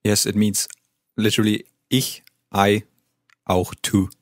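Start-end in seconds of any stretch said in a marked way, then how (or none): none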